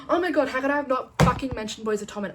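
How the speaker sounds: high, loud rush of horror at herself; shocked realisation. Real, not acted. Vlogger remembering her favourite series mid-video, real shocked outburst. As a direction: gasp and exclaim, appalled at the omission.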